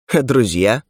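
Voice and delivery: ordinary delivery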